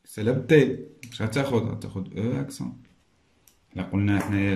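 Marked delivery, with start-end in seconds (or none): none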